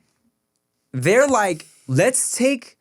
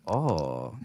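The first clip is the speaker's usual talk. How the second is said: low, soft, very slow broken English; imitating impressed elders; 8 semitones lower, 4 dB quieter, much slower than usual. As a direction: murmur it admiringly. Voicing old-time admirers.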